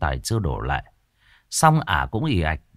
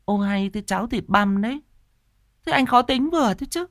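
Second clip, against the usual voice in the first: much higher, irritated woman's voice; annoyed wife; 12 semitones higher than usual. A narrator's reading. Wife, annoyed, defends the porridge to her husband. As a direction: snipe back at him. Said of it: "Tuyết khó chịu".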